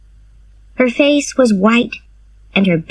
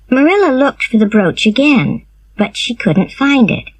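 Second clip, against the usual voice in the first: ordinary delivery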